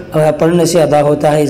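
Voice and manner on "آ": usual speaking voice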